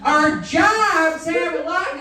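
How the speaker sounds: high, slow, drawn-out call to a driver; slow shouted call. Pretend. Yelling out the window at the tanker driver, slow and high. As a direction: stretch it out across the lanes.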